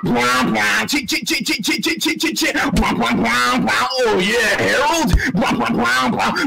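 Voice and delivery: mouthed bass drops and rapid hi-hats; beatbox a mock dance remix; rhythmic vocal imitation of a trashy remix; imitated remix. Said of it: "made a bass boosted Nightcore remix".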